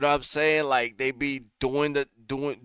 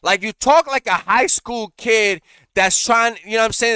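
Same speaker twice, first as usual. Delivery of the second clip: ordinary talk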